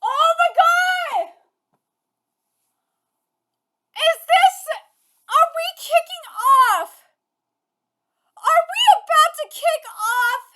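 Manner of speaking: high, loud, laughing and crying; real fan euphoria; ecstatic shout. Real, not acted. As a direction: scream with teary joy. Thrilled by chapter reveal.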